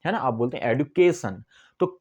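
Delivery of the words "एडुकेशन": "एडुकेशन" with a stressed, lengthened के. Shown as a demonstration. Teacher repeating the accent demo, "एडुकेशन" stressed on के. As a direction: hit the के in "एडुकेशन". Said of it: "के को आप थोड़ा सा stress".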